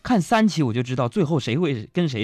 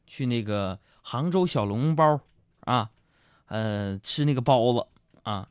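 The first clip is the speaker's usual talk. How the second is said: usual speaking voice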